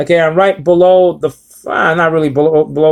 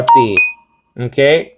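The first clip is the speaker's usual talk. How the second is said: normal talking voice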